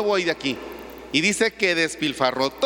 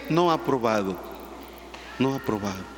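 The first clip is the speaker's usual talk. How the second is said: glum report; 8 semitones lower, 5 dB quieter than usual. Retold or imitated.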